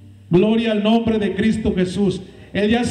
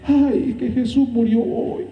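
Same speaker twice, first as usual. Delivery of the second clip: high mocking whine; mock pious excuse; 6 semitones higher than usual. Preacher mocking people's excuses, high whiny voice. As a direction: mimic people's hypocritical excuse. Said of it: "La gente".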